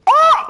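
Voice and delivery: sudden loud, very high "Ah!"; startled yell. Real, not acted. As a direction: yelp at the big play. Reacts to a move in match.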